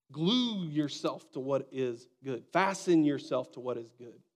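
slow, weighty emphasis; slow emphatic. Acted. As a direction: lean on each word slowly. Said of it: "sermon emphasis".

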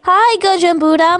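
ordinary talk, nothing marked

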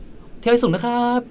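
sugary high welcome from a street gang; mock polite welcome. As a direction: play the thug gone suddenly polite.

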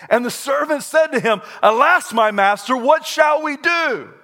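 loud, high, climbing reading of the verse; dramatic scripture reading. Acted. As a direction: read it like the climax of the story.

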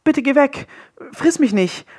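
put-on high, pleading frightened boy voice; a scared boy begging not to be eaten. A narrator's reading. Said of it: "sagte Willi".

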